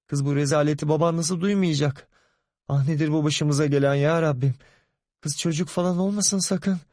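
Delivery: high, anguished scolding; mother fearing a pregnancy; lamenting mother. Acted. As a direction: wail at the disgrace.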